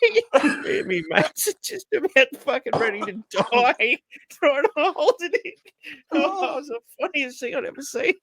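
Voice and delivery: high-pitched, helpless laughing speech; laughing while talking. Real, not acted. Telling how mates were dying laughing, really laughing. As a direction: squeeze the words out through real laughter. Teller cracking up at his story.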